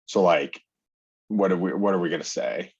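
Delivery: usual speaking voice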